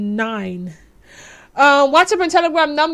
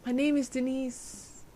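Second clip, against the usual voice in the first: hushed, coy small talk; mock shy introduction; 8 dB quieter than usual. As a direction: act the bashful lovers, barely audible, near giggling. Acts shy first meeting in film.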